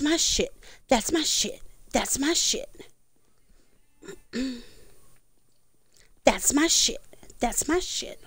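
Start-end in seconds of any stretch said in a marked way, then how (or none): 6.2-8.2 whispered, no voice at all. Shown as a demonstration. Whispering her catchphrase as a silly experiment. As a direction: breathe the words out silently. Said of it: "Don't know why I did that one".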